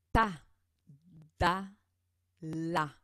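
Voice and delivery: teacher modelling "Pa, ba, ma" syllables; consonant demo. Shown as a demonstration. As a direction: model the lip sounds one by one, punchy. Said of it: "Je vous donne tout de suite un exemple".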